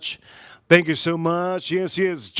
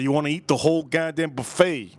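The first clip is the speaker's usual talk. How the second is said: usual speaking voice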